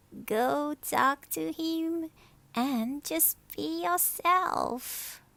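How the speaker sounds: high, slow grandmother voice. Acted. Abuela coaxes Martina to go talk to him, high and slow. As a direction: coax her kindly.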